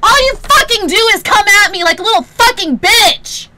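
screaming at chat at full force; real rage, shouted; furious yell. Real, not acted. Streamer yelling furiously at a viewer.

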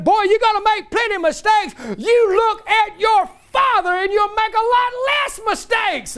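high-pitched mock yell of an angry father; a retold father's yell, pitched well up. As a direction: bark it up high like a furious dad in your face. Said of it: "he's yelling at me".

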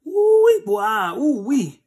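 high, loud shocked ooh-wee; ooh-wee reaction. Real, not acted. Preacher reacting to a crude joke, shocked. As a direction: react like the joke went too far.

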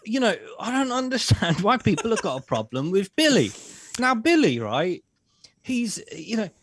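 hammed-up sarcastic admiration; mock praise. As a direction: gush about Billy with heavy irony. Sarcastic praise of Bill Gates.

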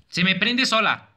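high, loud protest; alarmed complaint. Real, not acted. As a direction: yell it in exasperated alarm. Light switches on by itself.

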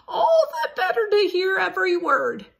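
high, loud granny-wolf voice; wolf as grandma. Acted. Wolf pretending to be grandma to Red, high put-on voice. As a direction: squeak it out like a wolf faking a grandma.

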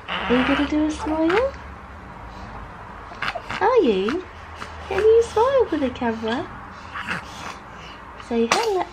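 high, swooping coaxing questions. Real, not acted. Mother asking babies to smile at the camera, cooing.

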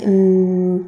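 hummed "mm" as a thinking pause; demonstrated "mm" filler. Shown as a demonstration. Teacher shows students the better filler, a hummed mm. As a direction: fill the pause with a closed-mouth hum. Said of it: "فالاحسن تعمل".

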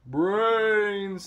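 loud, high, long zombie wail; zombie moan. A narrator's reading. Zombies staggering toward frightened pigs, loud moan. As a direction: stretch it into a hungry moan.

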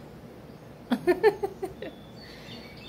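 high giggle; laugh. Real, not acted. After telling viewers the chores are no fun, a laugh. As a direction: laugh off the dull to-do list.

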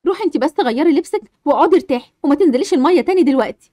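put-on calm the frightened child down; comforting mother.

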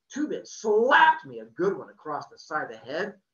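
plain reading voice

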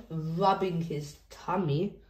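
ordinary delivery, nothing marked

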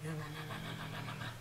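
normal talking voice

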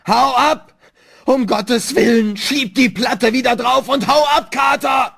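high, desperate full-voiced shout; wretched, high-pitched yell; pitiful scream. A narrator's reading. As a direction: scream a frantic plea. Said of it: "ein jämmerlicher Schrei Warrens".

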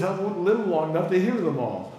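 quoting her line through a laugh, ending in breathy laughter; laughing while quoting. Retold or imitated. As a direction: laugh as you repeat the old lady's joke. Retold old lady's joking reply.

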